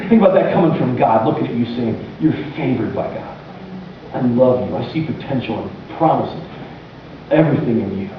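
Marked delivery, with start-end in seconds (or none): 2.2-8.1 low, soft and gentle blessing; God quietly blessing a listener, tender and low. Acted. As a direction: speak love over them quietly, lower and softer. God speaking favor over you.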